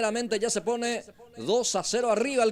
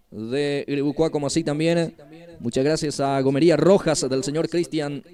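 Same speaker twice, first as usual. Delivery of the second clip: lower, steady radio ad read; sponsor read; 4 semitones lower than usual. Acted. Reads sponsor thanks.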